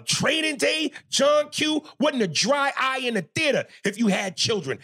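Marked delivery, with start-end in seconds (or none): 0.0-3.6 shouted higher, slowed titles; heated listing. Real, not acted. Host listing Denzel films to cohost, shouting. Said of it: "I'm getting mad now".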